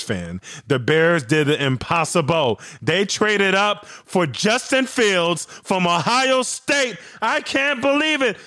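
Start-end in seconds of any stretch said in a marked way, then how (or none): 2.8-8.4 much higher, building to a shout of joy; can't contain the thrill, shout it out; real excitement. Real, not acted. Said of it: "I'm so excited".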